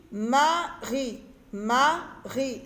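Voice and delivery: "Marie" slowly split in two, with a gap; syllable by syllable. Shown as a demonstration. Teacher sounding out the first syllable, "Marie" slowly split. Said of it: "J'entends A dans la première syllabe".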